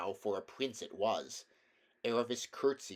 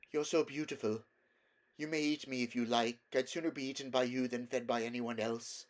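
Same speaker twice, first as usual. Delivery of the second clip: lightly voiced mare, meek and adoring; trembling mare, awed; 2 semitones higher than usual. A narrator's reading. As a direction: offer yourself humbly to the lion. Mare speaking to the lion.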